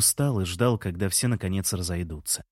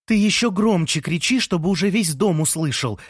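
ordinary delivery

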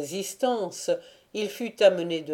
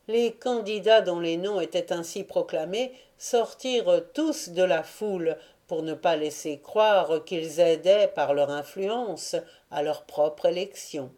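usual speaking voice

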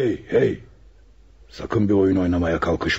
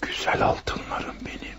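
greedy whisper; 7 dB quieter than usual. Acted. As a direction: whisper lovingly to the gold. Caressing the gold. (açgözlü bir fısıltıyla)

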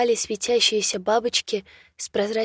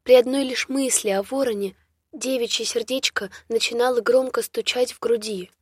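usual speaking voice

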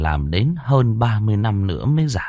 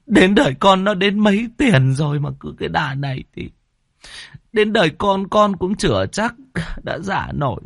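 higher, tearful pleading; whimpering plea; 10 semitones higher than usual. Acted. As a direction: beg the landlord near tears. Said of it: "Anh Khoai mếu máo". (mếu máo van xin)

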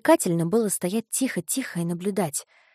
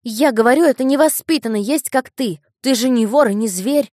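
put-on high, loud indignant boy; angry boy; 5 semitones higher, 9 dB louder than usual. A narrator's reading. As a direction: insist hotly, voice raised. Boy insists, scolding.

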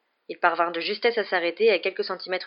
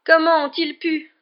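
high, loud, drawn-out sob of disbelief; tearful high-pitched cry; sobbing; 10 semitones higher, 8 dB louder, slower than usual. A narrator's reading. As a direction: wail it slowly in distress. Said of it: "sanglota-t-elle".

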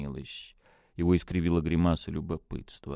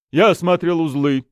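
ordinary delivery

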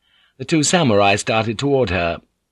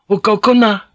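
high, loud woman's order; commanding; 12 semitones higher, 7 dB louder than usual. A narrator's reading. Fujiko orders the samurai back at gunpoint, high and commanding. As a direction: give a firm order, pitched high. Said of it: "she ordered".

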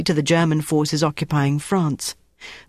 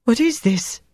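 shaky fear; 7 semitones higher than usual. A narrator's reading. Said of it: "Antoinette said shakily".

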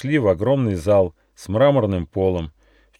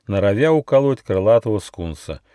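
ordinary delivery

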